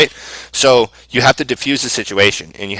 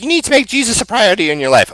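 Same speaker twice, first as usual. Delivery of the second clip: preachy lady; 11 semitones higher than usual. Retold or imitated. Client preaching about Jesus.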